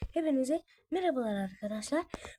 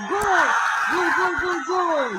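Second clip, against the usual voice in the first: full-throated goal cry, much higher and louder; screaming football commentator; 5 semitones higher, 6 dB louder, slower than usual. Real, not acted. Goal scored, celebrating.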